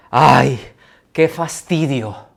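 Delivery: a read-out sigh of boredom, slow; bored ay, drawn out; weary sigh. Acted. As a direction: sigh the words as if tired of it. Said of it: "con signos de admiración".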